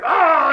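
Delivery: a sudden high wordless yell, retold; mock yell. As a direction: imitate the guy's shout at the house. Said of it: "and he's like".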